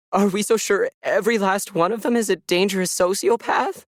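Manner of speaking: high, strained voice, begging to be understood; cornered witness pleading for her friend; pleading young woman. A narrator's reading. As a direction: defend him with rising desperation. Melissa confesses, pleading.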